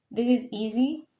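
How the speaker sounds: usual speaking voice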